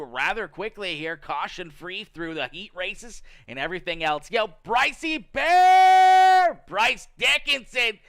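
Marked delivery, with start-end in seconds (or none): 4.3-8.0 long, stretched high shout of the name; calling out. Real, not acted. Streamer hyping a viewer's star donation, drawn-out high shout. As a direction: hail him across the room, slow and loud.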